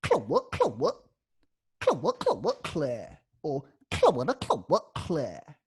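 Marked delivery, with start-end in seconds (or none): 0.0-2.5 Claire scratched way up high; high vocal scratch. Shown as a demonstration. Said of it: "your two scratch patterns". 3.9-5.6 second scratch pattern. Shown as a demonstration. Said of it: "your two scratch patterns".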